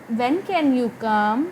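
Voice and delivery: very slow, "can" lifted, tone rising on "come"; gently ask, rising at the end; polite rising question. Shown as a demonstration. Teacher asking "When can you come?" politely, rising. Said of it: "politely इसको पूछूं".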